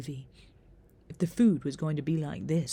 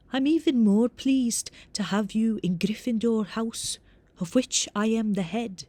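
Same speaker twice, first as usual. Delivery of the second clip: formal Scottish head of house, raised voice; higher and louder, with a reedy Scottish lilt; reedy Scottish accent; 5 semitones higher, 6 dB louder than usual. A narrator's reading. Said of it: "in a reedy Scottish accent".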